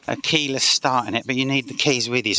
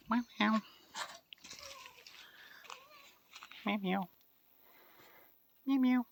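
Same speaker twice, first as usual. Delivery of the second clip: cat meow; 12 dB quieter than usual. Retold or imitated. Meowing at the cat.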